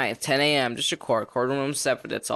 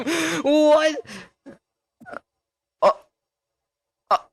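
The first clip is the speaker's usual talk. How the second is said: sharp, much higher shocked what; surprised; 13 semitones higher, much slower than usual. Real, not acted. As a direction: yelp in disbelief. Game suddenly freezes.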